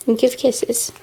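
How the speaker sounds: ordinary talk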